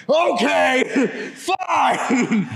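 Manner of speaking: high-pitched exasperated shout, laughing after; retold yelling. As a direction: blow up in mock exasperation. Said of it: "just started yelling it to him".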